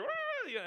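put-on very high childish squeal; little girl's squeal. Daughter squealing over a Barbie, high squeal. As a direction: squeal like a cross little girl.

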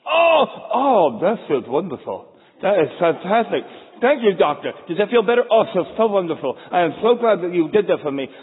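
gushing with numb relief, hammed-up; relieved patient. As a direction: coo over the Novocaine like it's heaven. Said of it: "He pulls out the Novocaine".

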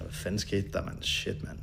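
quiet, shaken muttering to oneself; stunned inner voice. Retold or imitated. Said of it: "og tænker".

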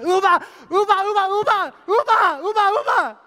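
put-on high, loud screamed baby chant; imitated frantic toddler yelling; mock toddler tantrum. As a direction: shriek it like a toddler jumping up and down.

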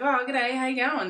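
imitated Aussie ticket man; Australian accent. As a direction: greet breezily in an Australian accent. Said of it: "he thought I was Australian".

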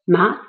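ma gliding sharply upward; rising second tone. Shown as a demonstration. Teacher reviewing the second of three tones, rising. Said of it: "the second".

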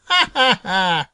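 high-pitched acted ha-ha-ha; spoken-out, merry animal laugh; rabbit's laugh. A narrator's reading. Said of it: "laughed Peter Rabbit".

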